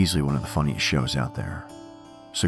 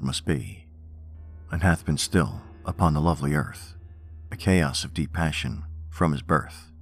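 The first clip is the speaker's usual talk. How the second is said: poem reading. Acted. Reads Poe poems aloud.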